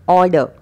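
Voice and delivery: ऑर्डर as loud, falling "aw-duh"; silent r. Shown as a demonstration. Teacher correcting learners, ऑर्डर without r. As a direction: end ऑर्डर on "uh", no r. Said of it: "अब ऑर्डर नहीं बोलेंगे".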